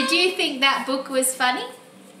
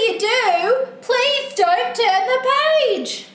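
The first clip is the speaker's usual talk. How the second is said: higher, drawn-out plea; pleading book character; 10 semitones higher, 6 dB louder, slower than usual. Acted. Book character begging the reader not to turn on, pleading. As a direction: beg with the words stretched out.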